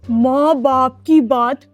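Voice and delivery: slow recitation of a lesson; rote memorizing. Acted. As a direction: drag out each word, learning by heart. Pupil resumes reciting his lesson.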